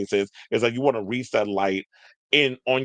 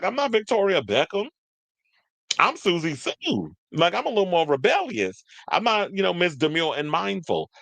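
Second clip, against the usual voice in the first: lightly voiced sassy [name], pitched higher; a hint of [name] shrugging off the posh image, higher; imitating [name]; 7 semitones higher than usual. Retelling [name]'s defiance in a light, higher mock voice. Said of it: "And she's like".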